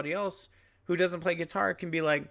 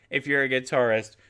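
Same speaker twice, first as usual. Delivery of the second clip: ordinary delivery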